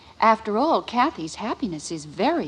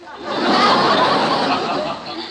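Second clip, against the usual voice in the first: breathy laugh; 7 semitones higher than usual. Acted. Wife laughing at her husband's grumpy retort, airy laugh. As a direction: let out a light breathless laugh.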